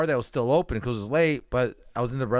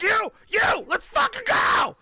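crazed threat; slower than usual. Retold or imitated. Pointing at each junkie, shouting a crazed threat. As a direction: go nuts pointing at each guy. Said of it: "just fucking went nuts".

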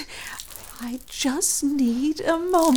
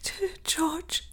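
faint, breathless whisper; wounded woman whispering for her lover; weak whisper; 13 dB quieter than usual. Acted. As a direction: barely get his name out. Wounded woman pleads weakly.

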